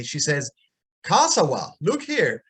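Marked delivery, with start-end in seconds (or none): none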